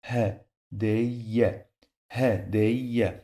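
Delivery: Ha, day, ye in separate beats, y in both last syllables; syllables, doubled y. Shown as a demonstration. Teacher reminding learners of the double letter, syllable by syllable. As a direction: sound out Ha, day, ye piece by piece. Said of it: "we pronounce this letter two times".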